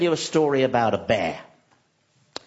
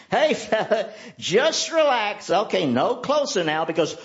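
coaxing the bear; 8 semitones higher than usual. Retold or imitated. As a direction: keep it gentle while the bear looms. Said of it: "the man's saying".

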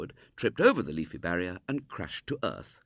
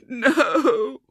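very high, loud tearful wail; wailing little girl; 12 dB louder than usual. A narrator's reading. Homesick girl answering William, loud sobbing wail. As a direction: wail it out on one broken word. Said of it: "wailed the little girl".

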